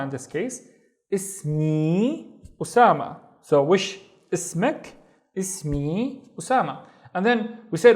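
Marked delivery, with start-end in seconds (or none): 1.1-3.2 Ismi Usama slowly, Ismi stretched at the end; slow model phrase. Shown as a demonstration. Teacher showing how to give a name, Ismi Usama slowly. 3.6-6.8 slow recap. Shown as a demonstration. Teacher recapping question and answer, slowly word by word.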